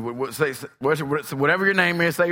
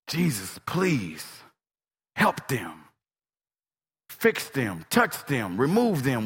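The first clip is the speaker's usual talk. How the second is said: low, soft prayer in short phrases; hushed, lowered appeal to Jesus; pleading prayer; 5 semitones lower, 4 dB quieter, slower than usual. Shown as a demonstration. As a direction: pray it quietly, pausing between pleas.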